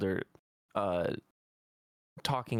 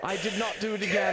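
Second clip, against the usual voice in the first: loud denial; 8 semitones higher, 4 dB louder, faster than usual. Acted. Heated denial in character.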